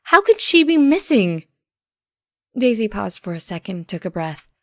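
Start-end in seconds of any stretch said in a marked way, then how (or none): 0.0-1.4 louder and rising higher in disbelief; can't believe what she's hearing; Octavia, disbelieving. Acted. Octavia protests to Daisy she saw Roseluck hours ago, higher and louder.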